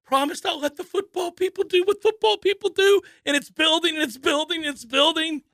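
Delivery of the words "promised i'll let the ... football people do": high, put-on, fussy voice; an owner primly refusing to step in; a lightly hammed owner voice; stubborn owner. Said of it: "the owner is like".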